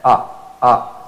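ah jolted out sharply from the gut. Shown as a demonstration. Teacher showing the shock of ah at the navel. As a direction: punch out a short ah. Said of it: "you feel there's a shock".

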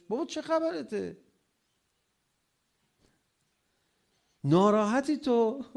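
high-pitched acted protest; exasperated challenge. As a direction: confront an irritable spouse, voice thrown right up. Acted challenge to irritable husband. (اعتراض کلافه با صدای خیلی زیر)

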